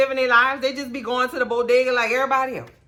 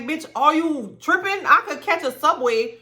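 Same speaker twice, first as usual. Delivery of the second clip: incredulous retort; 6 semitones higher than usual. Retold or imitated. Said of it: "And I'm like".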